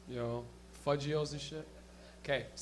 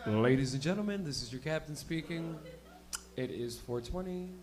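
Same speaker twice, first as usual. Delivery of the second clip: lightly voiced stoned captain on the intercom; lazy pilot announcing four twenty, low; captain announcement; 5 semitones lower, 4 dB quieter than usual. Stoned captain to passengers, mock intercom announcement. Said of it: "it comes on".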